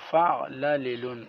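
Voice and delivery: فعللن with a short "i" on the second lam, fa'-la-li-lun; correct pronunciation. Shown as a demonstration. Teacher showing how it is to be read, فعللن pronounced fa'lalilun. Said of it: "اس طرح پڑھا جائے گا".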